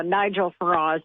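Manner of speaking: ordinary talk, nothing marked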